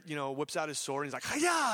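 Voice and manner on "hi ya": high, loud karate yell; mock karate shout as Peter attacks; battle cry. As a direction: swing the sword with a yell. Peter swings sword at servant.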